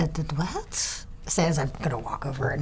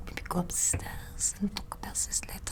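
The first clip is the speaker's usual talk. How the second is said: whispered; 9 dB quieter than usual. Acted. Characters hiding, whispering plans.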